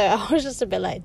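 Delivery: ordinary talk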